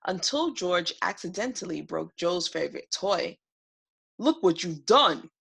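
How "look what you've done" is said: angry yell. Acted. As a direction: roar it at the boy like an angry dragon. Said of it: "Joe yelled".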